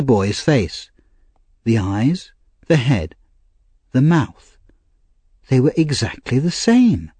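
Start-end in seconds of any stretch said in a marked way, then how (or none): none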